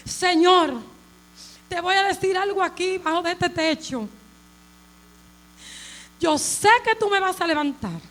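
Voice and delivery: fervent testimony building in force; impassioned preaching. Real, not acted. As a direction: preach it as a solemn vow to God. Preaches her promise to God.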